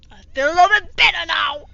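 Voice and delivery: fast sarcastic shout; mock shout. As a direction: yell it fast in mock relief. Repeating phrase, yelled.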